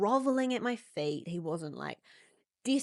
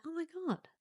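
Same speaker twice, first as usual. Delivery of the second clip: stunned gasp; 10 semitones higher, 6 dB quieter than usual. Real, not acted. Her stunned reaction to the mum.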